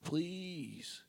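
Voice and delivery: soft, rising pleading moan; moaning whisper. Pretend. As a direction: beg in a hushed, high voice. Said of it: "pretend I'm getting a hand job".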